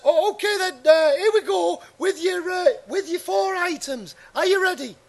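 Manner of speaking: bright TV presenter welcoming the audience, pitched high; Paul Daniels impression. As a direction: showman host on a Saturday night quiz. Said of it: "I'm Paul Daniels".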